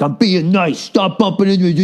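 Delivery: a hint of a petty old guy complaining; imitating annoyed stranger. Imitating angry stranger at game.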